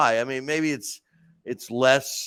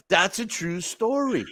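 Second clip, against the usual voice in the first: usual speaking voice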